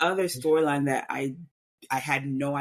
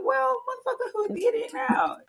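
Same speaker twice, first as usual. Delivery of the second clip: much higher, exasperated squeal; mock-outraged high-pitched outburst; sudden high squeal of outrage at the pronunciation; outraged disbelief.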